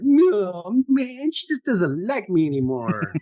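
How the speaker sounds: high, drawn-out pouty whine; mock him as a sulky pouter; pouty impression. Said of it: "he comes off more pouty".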